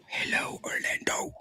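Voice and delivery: soft whispered greeting. Shown as a demonstration. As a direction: breathe the hello, no voice. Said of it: "Delma Rodriguez says".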